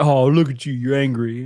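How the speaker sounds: put-on teasing baby voice to an angry girlfriend; mock baby talk. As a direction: coo at her like a pet. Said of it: "I'm just like".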